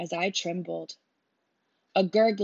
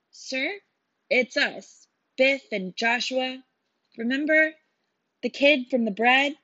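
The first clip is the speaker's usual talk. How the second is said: higher and louder, anxious to be recognised; nervous pleading; 7 semitones higher, 7 dB louder than usual. Acted. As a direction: plead fast with the soldier, nervous and eager. Boy pleads with armed soldier.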